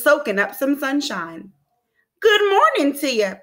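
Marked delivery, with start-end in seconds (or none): 2.2-3.3 high, cheery storybook bark; a hint of a yappy prairie dog, pitched up; lightly barked. A narrator's reading. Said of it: "barked Jeffrey".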